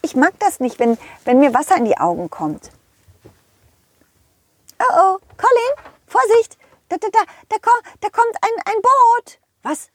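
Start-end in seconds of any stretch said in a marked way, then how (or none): 4.8-9.4 squeaky frantic warning; high pony shouting a warning; excited high horse voice. Acted. Rosa warns excitedly. (aufgeregte hohe Pferdestimme)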